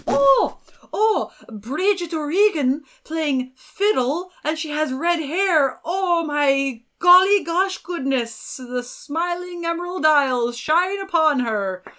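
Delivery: mock gushing, high and loud; hammed-up starry-eyed rapture; psyched older fans. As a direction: gush in slow, drawn-out delight like thrilled older folks. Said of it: "they're just so psyched".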